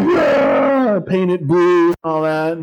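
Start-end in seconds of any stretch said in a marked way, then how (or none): 0.0-1.0 high, drawn-out mock war cry; hammed-up warrior shout; Joshua's battle yell. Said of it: "And Joshua's like".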